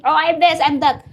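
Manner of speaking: mocking the bragging channels; mock braggart. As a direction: parrot the show-offs, puffed up. Mimics bragging channel owners.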